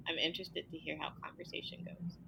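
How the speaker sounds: breathy half-whisper, fast; whispered aside. Real, not acted. Guest whispering an aside to the host before the segment. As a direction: tuck it in as a quick whispered aside.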